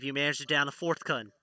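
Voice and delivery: usual speaking voice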